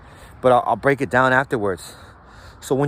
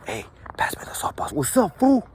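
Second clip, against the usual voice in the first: starts hushed then jumps high and loud on fool; loud call; 5 semitones higher than usual. Shown as a demonstration. Calling across the day room, breaking the whisper. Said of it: "But let's say, like".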